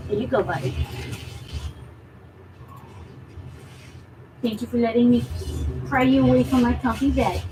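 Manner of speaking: soft pet talk. Real, not acted. Owner gently asks her cat whether to put him down, soft pet talk.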